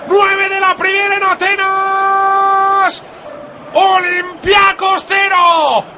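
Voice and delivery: slow, drawn-out, high shouted commentary; goal call. Real, not acted. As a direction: stretch every phrase of the goal call. Radio goal call after scoring.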